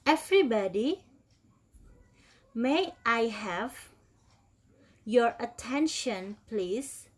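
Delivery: slowed-down, clear drill phrase; slow repeat. Shown as a demonstration. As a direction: stretch it out so students can follow. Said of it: "Oke, diulangi".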